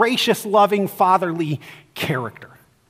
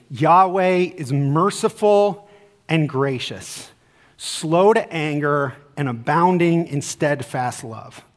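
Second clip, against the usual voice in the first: solemn scripture reading; 3 semitones lower, slower than usual. Acted. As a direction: let each phrase land slowly. Reads verse eight solemnly.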